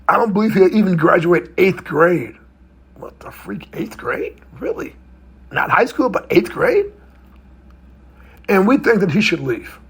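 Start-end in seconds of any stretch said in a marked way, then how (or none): 2.9-6.9 shocked disbelief. Real, not acted. Shocked aside about the nun.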